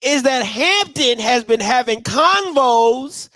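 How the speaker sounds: high, slow juicy gossip voice; nosy small town gossip. Pretend. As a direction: spill the tea like a nosy neighbor. Spilling gossip, far, slow.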